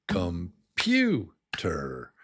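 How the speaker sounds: Computer very slow, PU drawn out; stress on PEW. Shown as a demonstration. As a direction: stretch the middle of Computer, syllable by syllable. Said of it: "accented syllable, like pew, is slower".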